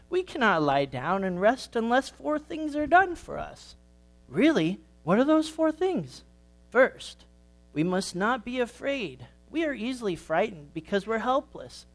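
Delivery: put-on wise old grandma sheep. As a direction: explain patiently like the oldest ewe. Grandma sheep explains to Sammy.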